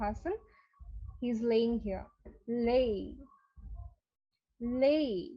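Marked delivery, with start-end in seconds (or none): 2.5-5.3 লে with a gliding ey ending; correct pronunciation. Shown as a demonstration. Teacher models the word lay with a picture. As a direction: let লে slide into ay. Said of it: "এটা ইংরেজি হচ্ছে লে".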